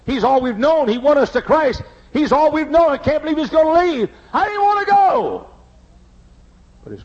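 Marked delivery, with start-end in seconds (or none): 0.1-4.1 mild mock wailing. 4.3-5.5 near tears. Real, not acted. Recalls leaving, voice breaks high.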